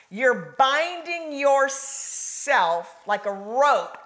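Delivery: loud, drawn-out declarations; full-force preaching. Real, not acted. Preacher urging listeners to bind themselves to God, full-force preaching. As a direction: preach it big, stretching the key words.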